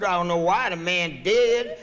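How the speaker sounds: ordinary delivery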